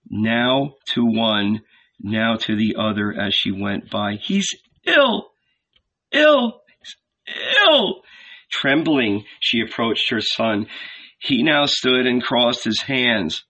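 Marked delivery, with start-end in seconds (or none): 4.2-8.0 high, anguished cry with pauses between words; tearful high wail; distraught plea. A narrator's reading. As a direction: plead it, each word more desperate. Distraught mother pleads to passers-by.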